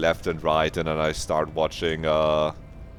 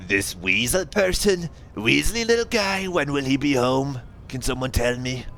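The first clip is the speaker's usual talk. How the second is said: role voice; 5 semitones higher than usual. Acted. Interrogating dock worker in character.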